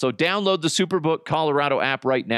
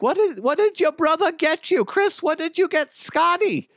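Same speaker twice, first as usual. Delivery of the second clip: curious mom, pitched way up; imitating mom. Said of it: "She's like".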